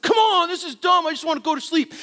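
put-on whiny kid. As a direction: plead with dad to let him sleep. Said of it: "I said".

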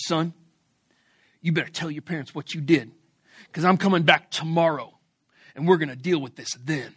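low, measured mock police warning; cop in your face. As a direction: imitate a stern cop's deliberate threat. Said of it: "he just gets right in my face".

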